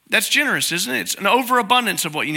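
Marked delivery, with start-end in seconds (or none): none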